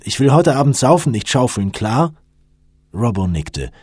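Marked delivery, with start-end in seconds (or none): 0.0-2.1 raised, insistent voice; firm, decided. A narrator's reading. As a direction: lay down the law to the others. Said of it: "sagte er entschieden".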